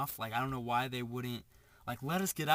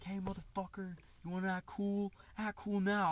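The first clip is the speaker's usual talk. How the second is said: higher-pitched imitation of an aggressor; put-on confrontational voice, pitched up; mock angry driver; 5 semitones higher than usual. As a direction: taunt like a worked-up driver, but don't shout. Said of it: "he's, like, angry".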